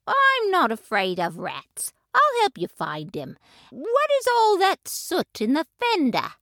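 a hint of a brisk neighbor cat; calm cousin offering help; lightly voiced Ribby. A narrator's reading. Ribby answers Tabitha.

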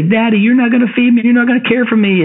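put-on high, pleading little boy voice; mock-sobbing child begging daddy; whiny crying child. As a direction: whimper it like a scared hungry kid. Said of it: "they were crying and sobbing".

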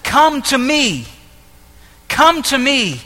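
slow, drawn-out divine invitation; God. Acted. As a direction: lightly voice God calling someone near. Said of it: "God says".

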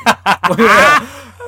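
big burst of laughter; loud laugh. Real, not acted. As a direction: cackle out loud.

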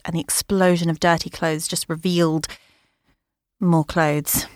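ordinary delivery, nothing marked

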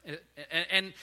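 usual speaking voice

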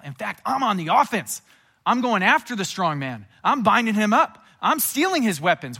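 rousing, full-voiced preacher's proclamation; forceful declaration. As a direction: punch each claim like a fighter on the attack. Acting Jesus going on the attack.

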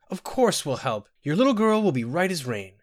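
high, tearful reassurance; bawling. A narrator's reading. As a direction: reassure them through mild wailing. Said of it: "the Nord woman bawled".

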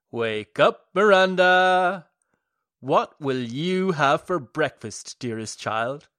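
loud, booming, gleeful wake-up call; booming gleeful madman. A narrator's reading. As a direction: boom it out like a happy lunatic. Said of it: "gleeful, booming voice".

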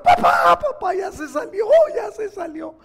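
put-on loud high child yelling. Boy under the belt screaming to his father, loud high yell. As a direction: howl for dad to stop. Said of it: "el niño empezó a gritar".

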